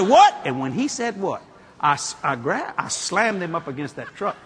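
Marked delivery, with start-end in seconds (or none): 0.0-0.3 loud challenge. Retold or imitated. Car dealer turns on the speaker, loud and high. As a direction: bark it back at him, high.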